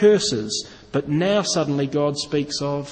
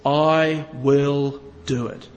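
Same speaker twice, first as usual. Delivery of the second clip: "I will do it" slow and deliberate, I stressed; God's promise spoken slowly with weight; slow, weighty I; 3 semitones lower, much slower than usual. Shown as a demonstration. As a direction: let "I" land heavy, slowly. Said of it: "No, God says".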